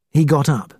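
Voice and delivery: plain reading voice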